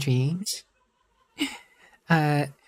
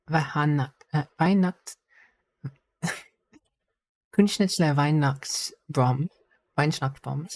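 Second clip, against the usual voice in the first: halting, clumsy German accent; scuffy German accent; much slower than usual. Shown as a demonstration. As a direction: stumble through it in bad German. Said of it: "I have to say this in the scuffiest German accent ever".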